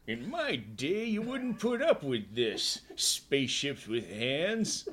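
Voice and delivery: put-on slow, high grumbling old-timer; curmudgeonly old prospector. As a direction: drag it out like a grumpy old miner. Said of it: "the curmudgeonly old prospector".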